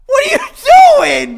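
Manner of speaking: shout. Real, not acted. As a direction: yell at him in disbelief. Reacting to spilled beer.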